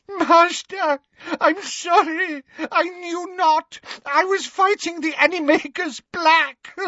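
very high, wailing apology; sobbing. A narrator's reading. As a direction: plead in sobs with the master. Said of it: "sobbed Bellatrix".

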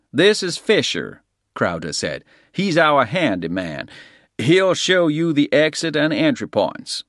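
lightly voiced hotel manager; Crowder's voice. A narrator's reading.

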